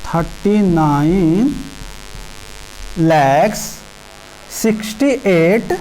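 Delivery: very slow, one chunk at a time, long pauses between; slow dictation. Shown as a demonstration. Teacher dictates the number to children, drawn out slowly. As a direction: dictate it slowly while writing on the board.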